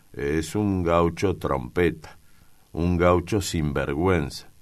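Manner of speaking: ordinary delivery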